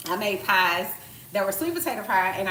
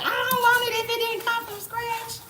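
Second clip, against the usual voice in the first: much higher put-on snob voice; mocking picky eater; 4 dB louder than usual. Mimicking people who only eat homemade, high snobby voice. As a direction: sneer like a food snob. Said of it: "They be like".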